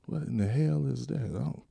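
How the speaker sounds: normal talking voice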